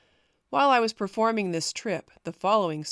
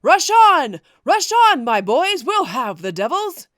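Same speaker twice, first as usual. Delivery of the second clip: loud, high battle cry; rally the troops with a full-voiced yell; yelling to rouse imaginary men behind him; shouting at the top of voice; 8 semitones higher, 13 dB louder than usual. A narrator's reading. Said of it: "calling in the meantime at the top of my voice".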